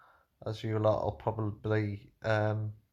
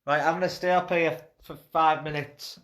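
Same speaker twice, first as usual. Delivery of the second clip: loud, high calling; 7 semitones higher, 11 dB louder than usual. Real, not acted. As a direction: shout it down the stairs. Calling down to the group.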